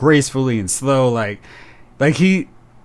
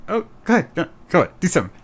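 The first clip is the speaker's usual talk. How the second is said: higher, softer teasing dare; mock taunting; 4 semitones higher, 4 dB quieter, much faster than usual. Vader taunting Reva in the fight, a higher, softer teasing dare. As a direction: toy with her like a cat with a mouse. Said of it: "he is taunting and playing with her".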